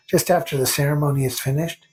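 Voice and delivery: ordinary delivery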